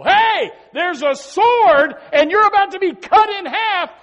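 urgent, high, drawn-out yell; full-voiced pulpit shout; shouted warning. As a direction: shout it like a watchman warning of danger. Acts out watchman shouting a warning.

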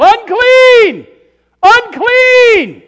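high, loud wailing warning cry; yelling unclean. Acted. Leper family warning passers-by, loud high yell. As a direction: cry it out to warn people away. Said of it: "they yell".

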